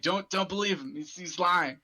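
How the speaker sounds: high, loud desperate plea; desperate cry. Acted. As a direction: shout a warning in panic. Sildar cries out, pleading.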